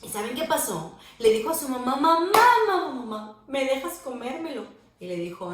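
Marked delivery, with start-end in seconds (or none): 2.0-4.7 put-on a light, higher child's plea; pleading little girl. Susana asking her mom for cake, lightly voiced pleading child. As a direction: beg mom like a little kid.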